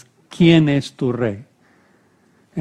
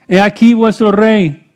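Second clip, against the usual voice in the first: high, ringing announcement; loud proclamation; 7 semitones higher, 8 dB louder than usual. A narrator's reading. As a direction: declare it loudly to the crowd. Pilate presents Jesus as king.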